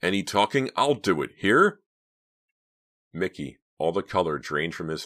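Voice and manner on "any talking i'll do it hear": loud, high, menacing shout; angry barked warning; screamed threat. A narrator's reading. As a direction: threaten loudly, last word jumping up. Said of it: "as he screamed".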